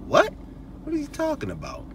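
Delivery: high, fast, puzzled woman's voice; confused woman. Retold or imitated. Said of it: "'Cause now she's like".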